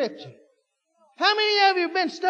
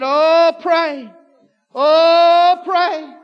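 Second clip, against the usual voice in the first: loud wailing cry of oh, pray; imitating a crying plea; 4 semitones higher, 12 dB louder, much slower than usual. Preacher mimics the crying daughter at the meeting, wailing loud. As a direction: wail it like a woman crying out in church. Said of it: "Oh, and she cried".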